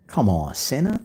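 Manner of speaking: normal talking voice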